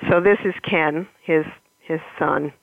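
usual speaking voice

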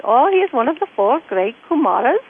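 lilting imitation Indian accent. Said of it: "Great Indian accent".

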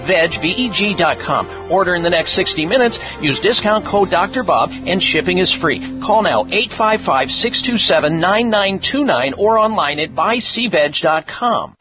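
radio ad read over music; infomercial. Acted. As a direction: pitch the product to listeners. Host reads his own ad.

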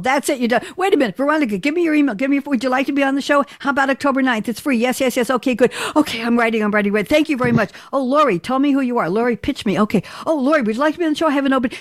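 high-pitched, rushed retelling of a frantic exchange; acted-out frantic speed-pitch chatter; hurried reenactment. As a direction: rattle it off against the stopwatch. Reenacts hurried two-minute pitch meetings.

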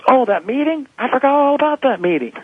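wife suddenly perky and high on the phone; wife caught forgetting, high and chipper; mock bright phone voice. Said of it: "all of a sudden she goes".

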